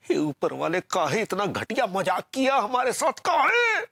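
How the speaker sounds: high complaint climbing to a wail; anguished lament. Acted. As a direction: protest to heaven, rising to a cry. Lamenting fate to the heavens. (ऊपर वाले से रोते हुए शिकायत)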